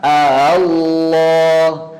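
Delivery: "Allah" with a softened second hamzah, gliding a-ha-llah; tashil. Shown as a demonstration. Teacher showing the second way, hamzah eased by tashil. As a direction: ease from the first A into "Allah" without a hard stop, slowly. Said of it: "Hamzah wasalnya ditashil".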